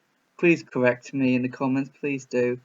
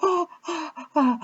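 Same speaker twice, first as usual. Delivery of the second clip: very high mock-crying swoon; swooning fan. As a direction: sigh like an overwhelmed fan in the crowd. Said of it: "people go".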